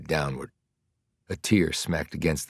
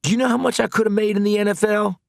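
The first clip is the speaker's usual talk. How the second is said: bitter outrage; angry, fast and higher; 6 dB louder, faster than usual. Acted. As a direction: spit it out fast and loud. Mars angrily rising.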